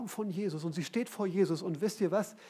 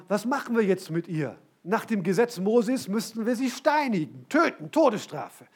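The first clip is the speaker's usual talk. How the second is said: acted accusers' demand; 3 semitones higher, 6 dB louder than usual. Religious accusers demand that Jesus condemn her, increasingly loud and insistent. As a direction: build to a forceful cry for punishment.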